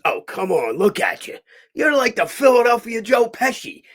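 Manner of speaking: loud, high-pitched insistence; acted-out exclamation. Old actor exclaiming at his lookalike.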